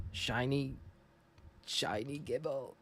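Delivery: awed surprise. Real, not acted. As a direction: breathe out the name in wonder. Excited at shiny Gible.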